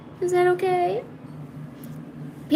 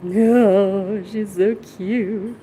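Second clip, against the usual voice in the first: cooing; 3 semitones lower, much slower than usual. Real, not acted. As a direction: melt over how cute she is. Cooing over the cat.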